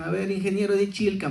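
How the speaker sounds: teasing. Retold or imitated. Children in Cusco test the engineer's pronunciation, teasing. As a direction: lightly imitate children making fun of an outsider. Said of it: "motivo de, de burla de los niños".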